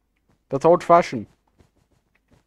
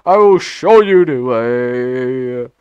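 slow, drawn-out meme intonation; Knuckles meme voice; 3 semitones lower, 5 dB louder, much slower than usual. Shown as a demonstration. Gamer redoing the line properly for teammates, slow and drawn-out. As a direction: stretch it out, savour each word. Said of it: "let me say that better".

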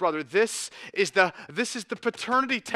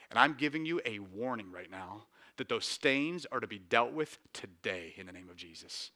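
softer, lower, intimate pastoral warning; hushed earnest warning; 6 semitones lower, 8 dB quieter than usual. Real, not acted. Pastor quietly warning the congregation about stains, hushed. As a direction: lean in and speak to the few quietly.

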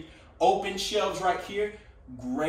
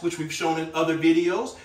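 ordinary talk, nothing marked

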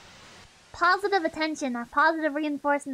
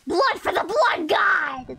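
battle cry; much slower than usual. Pretend. Chanting a famous battle cry.

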